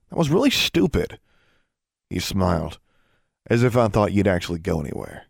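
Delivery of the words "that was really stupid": higher-pitched complaint; complain, pitch jumping up. A narrator's reading. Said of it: "she complained".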